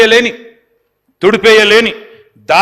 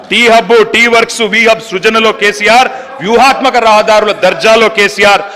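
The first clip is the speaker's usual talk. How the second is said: declaimed political litany with a repeated refrain; rhetorical roll call; 2 semitones higher, 5 dB louder, slower than usual. Real, not acted. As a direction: build a rhythmic list, landing each refrain firmly. Chanting roll-call in assembly speech.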